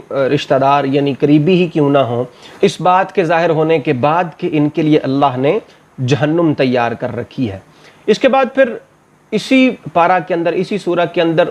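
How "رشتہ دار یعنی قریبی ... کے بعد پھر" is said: usual speaking voice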